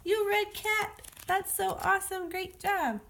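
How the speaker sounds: put-on high, excited cheering for a child; acted celebration. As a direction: cheer a new reader in a high voice. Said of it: "throw a little party".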